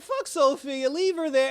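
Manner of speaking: loud, high-pitched retold brush-off. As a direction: belt it out, dismissive. Voicing Penguin abandoning Sophia.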